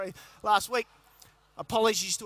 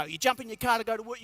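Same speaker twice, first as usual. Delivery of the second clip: ordinary talk, nothing marked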